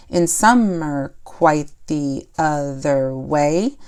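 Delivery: "In winter, I get up at night" recited slowly, leaning on every second syllable; rhythmic beat. Shown as a demonstration. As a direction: stretch "to go to bed by day" into a slow, steady alternating beat. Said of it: "So that's the pattern there".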